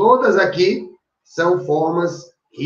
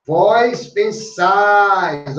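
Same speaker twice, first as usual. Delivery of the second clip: "Vós pensais" stretched very slowly, ending stressed; slow, emphatic teaching demo; much slower than usual. Shown as a demonstration. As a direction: draw out "pensais" to mark the final stress. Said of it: "a risotônica".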